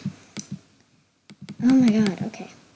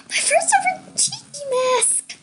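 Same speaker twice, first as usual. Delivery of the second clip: excited; 14 semitones higher than usual. Real, not acted. Gets first ever tiki mask.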